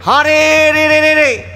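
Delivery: very high, loud rolling war cry; full-voiced attack holler; bandits' yell. A narrator's reading. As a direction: scream out a charge, held long. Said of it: "চেঁচিয়ে উঠল".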